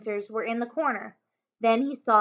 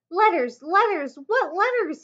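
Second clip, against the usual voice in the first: frantic; 6 semitones higher, 3 dB louder than usual. A narrator's reading. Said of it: "He looked around wildly".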